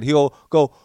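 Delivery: ordinary talk, nothing marked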